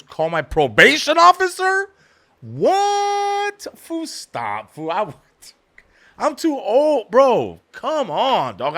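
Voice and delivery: high, drawn-out disbelieving whine; incredulous balking. Retold or imitated. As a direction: plead against an absurd idea. Balking at having a probation officer.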